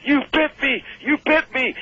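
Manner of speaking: high, loud outraged complaint; outraged yell. Retold or imitated. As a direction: yell it like you were just bitten. Tony yelling after ear bitten.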